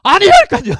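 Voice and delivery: shouted, high-pitched denial; angry outburst. Retold or imitated. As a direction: explode in a comically furious yell. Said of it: "화나셨잖아요".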